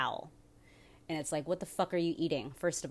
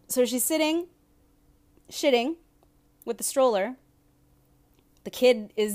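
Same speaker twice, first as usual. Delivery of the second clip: incredulous; 7 semitones higher, 8 dB louder than usual. Real, not acted. Disgusted retelling of bathroom scene.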